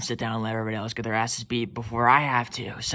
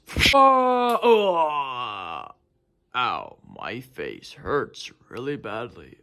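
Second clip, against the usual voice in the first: battle cry then pained groan; 4 semitones lower, much slower than usual. Acted. Toy fight hit.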